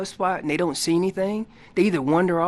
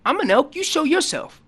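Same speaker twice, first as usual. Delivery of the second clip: elk challenging; 9 semitones higher than usual. Retold or imitated. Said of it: "All I'm telling them is".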